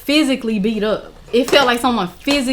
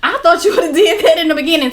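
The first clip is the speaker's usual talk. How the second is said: higher-pitched imitation of a scolding friend; incredulous scolding; 6 semitones higher than usual. Friend and mom chide her for not checking him out, incredulous. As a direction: mimic disbelief, pitch climbing as it goes on.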